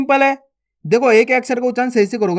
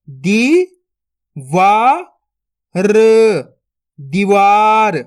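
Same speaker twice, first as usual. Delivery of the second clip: each syllable spaced out, then the word blended slowly; syllable-by-syllable reading; 4 dB louder, much slower than usual. Shown as a demonstration. Teacher to young students: slow sounding-out of each syllable.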